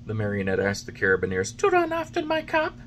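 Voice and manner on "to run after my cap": put-on high wooden-boy voice, anxious; high puppet boy. A narrator's reading. Pinocchio answering the carabineers, anxious and high.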